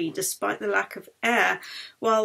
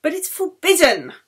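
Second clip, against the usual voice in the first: higher, louder objection; indignant protest; 8 semitones higher, 9 dB louder than usual. Acted. Matron objecting again, higher and louder. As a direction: insist it is against rules.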